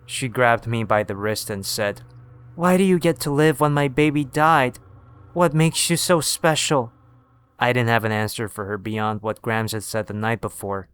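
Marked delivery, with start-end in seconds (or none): 2.5-6.9 put-on bitter, accusing old woman; angry old woman. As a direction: lash out in grief. Grieving mother grabs boy.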